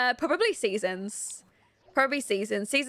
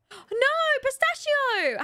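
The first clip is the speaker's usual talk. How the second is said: high, drawn-out wail of concern; alarmed, much higher pitch; dismayed cry; 12 semitones higher, much slower than usual. Real, not acted. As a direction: cry out to a stranded pet. Dismay at pet stuck outside.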